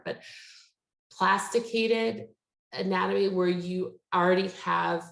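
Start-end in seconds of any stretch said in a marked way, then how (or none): 1.1-2.3 "plasticated" sounded out slowly, syllable by syllable; careful pronunciation. Real, not acted. Lecturer attempting a word they can never pronounce, "plasticated" said slowly and carefully. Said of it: "I can never pronounce this word".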